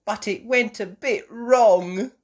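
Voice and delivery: put-on high, loud, slowish little-girl voice; child explaining. Child admitting breakfast went wrong, explaining. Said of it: "explained Peppa".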